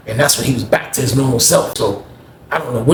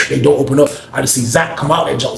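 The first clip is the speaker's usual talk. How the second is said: ordinary talk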